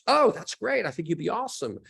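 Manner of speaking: high, bright mock of an encouraging actress; lightly voiced cheerful mentor; imitating Allison Janney. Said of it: "She goes".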